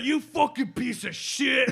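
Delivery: high, slow mock screaming insult. Telling friends how Greg screamed at a stranger, mock yell. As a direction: spit out the insult like an angry fisherman. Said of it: "Greg screamed at a guy".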